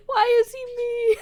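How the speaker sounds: high, loud laughing wail. Real, not acted. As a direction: crack up while asking. Player cracking up.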